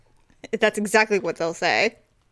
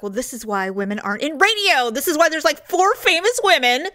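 mock self-disgusted rant; 6 semitones higher than usual. Rants at her own voice, self-quote.

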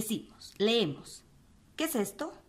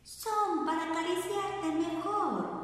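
much higher, sugary fake granny voice; wolf's sweet voice; 9 semitones higher than usual. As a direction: coo it sweetly to hide the wolf. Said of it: "contestó con voz dulce el lobo".

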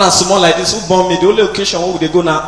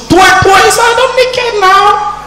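panicked squeal; 4 dB louder, slower than usual. Retold or imitated. Mimics gunfire and panic.

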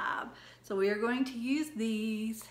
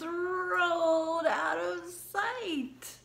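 slow drawn out; 8 semitones higher, 4 dB louder, much slower than usual. Acted. Drawn-out ending of rhyme.

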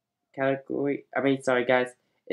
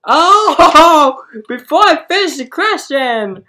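laughing; 9 semitones higher, 6 dB louder than usual. Real, not acted. Host laughing through question.

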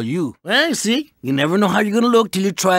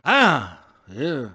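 usual speaking voice

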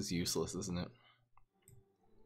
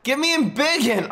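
higher, louder desperate yell; 13 semitones higher, 7 dB louder than usual. Real, not acted. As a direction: yell for it at the top of your voice. Escalated plea for the card.

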